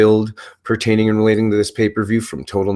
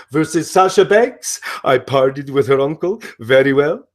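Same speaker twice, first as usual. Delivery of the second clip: Broken Matt Hardy impression; 2 semitones higher than usual. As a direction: play the unhinged, grandiose wrestler announcing matches. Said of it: "the very complex character of Broken Matt Hardy".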